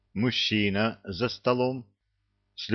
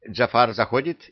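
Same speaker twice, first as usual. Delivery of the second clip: ordinary delivery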